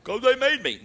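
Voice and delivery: high, mock-childish "'Cause they made me"; whiny excuse. The preacher's own inner excuse-maker answering back, in a mock whine. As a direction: play the sulky self making excuses. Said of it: "looked at this fellow here and said".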